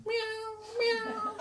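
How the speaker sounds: high, plaintive imitation meow; high-pitched mock cat call. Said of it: "But it's going".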